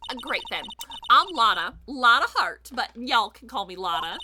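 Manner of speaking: chatty Southern twang; generic Southern accent. Acted. Photographer meeting the investigators, in a friendly Southern accent. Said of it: "doing a very generic Southern accent".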